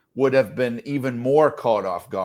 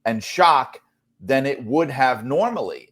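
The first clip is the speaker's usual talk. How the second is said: ordinary talk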